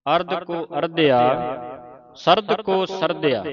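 each phrase said whole, resting after ਅਰਧਿਆ and ਸਰਦਿਆ; pause after ਸਰਦਿਆ. Shown as a demonstration. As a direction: recite ਸਰਦ ਕੋ ਸਰਦਿਆ unbroken, then stop. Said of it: "ਸਰਦਿਆ ਤੇ ਵਿਸਰਾਮ ਲਾਉਣਾ".

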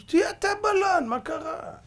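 high-pitched, pushy comeback; mock incredulous. Mordechai telling Haman to be the bath attendant, high and pushy. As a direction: toss it back high and insistent. King's minister orders Haman about.

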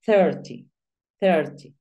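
"thirty" said twice, clear th at the start; model pronunciation. Shown as a demonstration. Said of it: "Tú dices".

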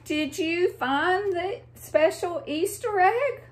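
high excited squeal; squealing friends. Acted. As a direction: squeal the question like eager little animals.

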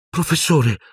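high, anguished cry of Professore; a boy's sudden anguished call; anguished outburst. A narrator's reading. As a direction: blurt it out in distress. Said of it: "proruppe angosciato".

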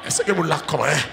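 loud, high, fervent sermon cry; preaching at full force. Acted. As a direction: preach it out to the whole church. Preaching to congregation. (prédication à pleine voix)

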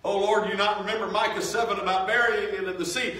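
high, loud begging cry to the Lord; mock desperate plea. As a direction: plead loud and high like a panicked sinner. Pleading with God, mock plea.

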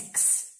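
"x" as k plus s, voiceless; sound demo. Shown as a demonstration. Teacher spelling the sound out, "x" as quiet "ks". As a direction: let "x" out as a quiet "ks". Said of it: "K com S".

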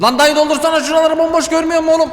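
high, fast exasperated outburst; frantic scolding. Real, not acted. Player yelling at the worker to refill empty shelves, frantic scolding. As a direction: rattle off a high-pitched complaint.

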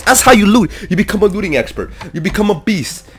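loud, high-pitched excited boast; hyped-up bragging. Real, not acted. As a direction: crow about it, loud and high. Bragging loudly while looting.

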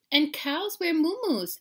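put-on high, loud, slow girlish voice; little sister. A narrator's reading. Sister adds to mama's lesson, high girlish voice. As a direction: stretch it out like a child.

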